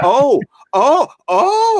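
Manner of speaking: imitated high, rising "oh"s of amazement; retold amazed reaction. Retelling her reaction to thirty seconds of the teaser, mock amazement. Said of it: "And she just went".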